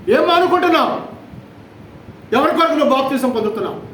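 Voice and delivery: preaching at full force. Acted. Preacher challenging the congregation about baptism, forceful preaching. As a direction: press the congregation hard with each question.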